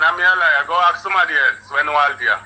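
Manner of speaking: normal talking voice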